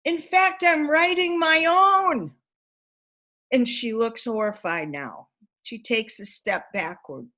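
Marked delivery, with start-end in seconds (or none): 0.1-2.3 proud and eager. Retold or imitated. I was so happy.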